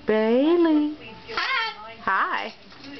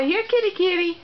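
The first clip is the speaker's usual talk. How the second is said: high kitty call; 6 semitones higher than usual. Real, not acted. Calling the cat to come.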